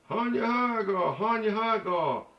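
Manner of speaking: high, drawn-out wail; mimicked tipsy woman. Drunk woman out of a car, mimicked high and drawn out. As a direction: sing it out high and slurring.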